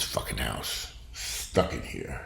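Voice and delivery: slow, breathy, almost whispered; muttered. Real, not acted. Stuck at home renovating, muttered under his breath. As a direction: mutter it to yourself, worn out. Complaining about house.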